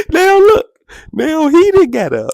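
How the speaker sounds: real laughter breaking through the story; laughing excitedly. Real, not acted. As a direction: voice cracking high with laughter. Laughing while retelling the fight.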